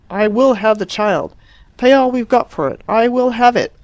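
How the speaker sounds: loud, emphatic insistence; determined. Acted. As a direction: insist hard, word by word. Mother insisting firmly.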